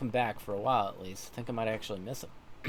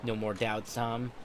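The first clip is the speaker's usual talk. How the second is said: normal talking voice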